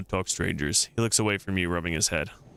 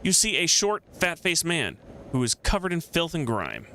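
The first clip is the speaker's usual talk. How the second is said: ordinary talk, nothing marked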